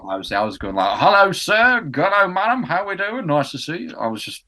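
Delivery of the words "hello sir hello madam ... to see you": loud, high Cockney newspaper seller; Cockney street vendor's cry, pitched way up; Cockney accent. Acted. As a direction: call out to passers-by in broad Cockney. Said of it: "how about a Cockney accent".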